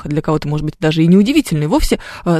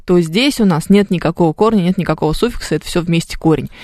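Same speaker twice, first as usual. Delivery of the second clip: usual speaking voice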